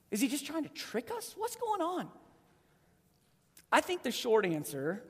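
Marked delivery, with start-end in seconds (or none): 0.1-2.1 softer and quick, wondering; puzzled aside. Real, not acted. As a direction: ask it half to yourself. Puzzled questions, voice drops.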